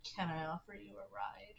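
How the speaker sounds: a quiet, faintly creepy invitation; headcanon Ford. Acted. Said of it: "That's how he says it in my headcanon".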